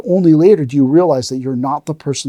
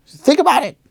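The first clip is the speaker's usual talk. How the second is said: high put-on voice of a curt teacher; hammed-up dismissal of a pupil; mock stern master; 7 semitones higher than usual. Teacher sending the student away to think, mock stern.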